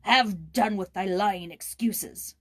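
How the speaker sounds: slow, loud, contemptuous rebuke; rough answer. Acted. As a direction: cut the boy's excuses off hard. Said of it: "was the rough answer".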